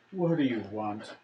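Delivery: low, soft, sighed-out question; weary answer to a knock; sigh, then ask it low and quiet; Tinder at door. Acted. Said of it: "Tinder says".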